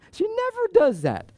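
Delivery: high incredulous exclamation; surprised. Real, not acted. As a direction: say it amazed at the dog. Surprised about the dog growling.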